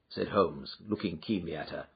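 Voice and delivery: ordinary delivery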